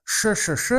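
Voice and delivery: "sh, sh, sh" in evenly spaced syllables; tongue-made sh sound. Shown as a demonstration. Speaker demonstrating dialect sounds to try, repeated hushing "sh". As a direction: show off the tricky "sh", repeated. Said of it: "It's like".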